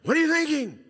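high, loud exasperated parent demand; what are you thinking, hammered high and loud; scolding parent. Shown as a demonstration. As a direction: bark it at a kid who messed up.

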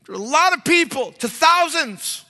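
put-on high, loud, starry-eyed voice of a young woman; mock her big dreams in a high excited voice; imitating a gushing young churchgoer; eager young woman. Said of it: "and she said".

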